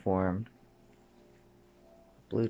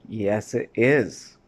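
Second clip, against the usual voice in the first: firm retort; 8 dB louder, much slower than usual. Real, not acted. Retort to the mocked excuse.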